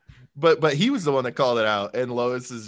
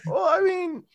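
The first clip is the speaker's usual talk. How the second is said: very high, squeaky falsetto; squeaky mock-indignant woman; old woman voice. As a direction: put on an extra-high old lady scolding. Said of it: "when you pretend to be a woman, why is it an, always an old woman".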